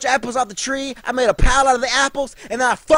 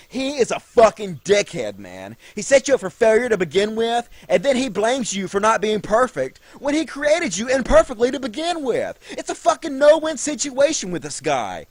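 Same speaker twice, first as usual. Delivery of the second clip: ordinary delivery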